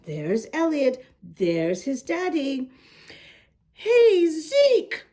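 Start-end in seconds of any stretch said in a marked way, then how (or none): 3.7-5.1 shouting. A narrator's reading. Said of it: "shouted Elliot".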